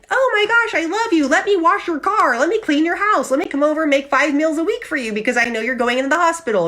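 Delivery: hammed-up elation, promising everything at once; mock elated gushing. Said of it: "we could be so elated".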